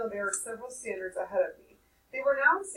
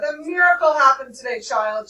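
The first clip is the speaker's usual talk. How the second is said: loud, high announcing; hint at a secret with joy; granny teasing with good news; excited, mysterious; 5 semitones higher, 12 dB louder than usual. A narrator's reading. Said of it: "she added enigmatically".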